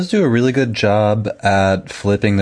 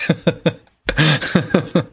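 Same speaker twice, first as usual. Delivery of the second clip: laughing; 11 semitones higher than usual. Retold or imitated. Mat embarrassed, covering himself.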